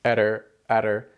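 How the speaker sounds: etter, adder with the flap alone; isolated flap. Shown as a demonstration. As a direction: tap the tongue once. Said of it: "and isolate it".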